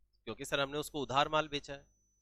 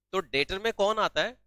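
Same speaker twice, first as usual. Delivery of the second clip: ordinary talk, nothing marked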